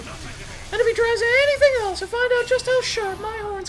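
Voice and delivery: high cartoon heroine voice; high girly heroine voice. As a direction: play the plucky captive girl. Heroine voice threat.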